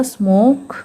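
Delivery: "स्मोक" slowly, with a held long oh; long O sound. Shown as a demonstration. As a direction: draw out the o in "स्मोक". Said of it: "ओ को हम उसके long sound से पढ़ेंगे". ("स्मोक" में ओ को लंबा खींचें)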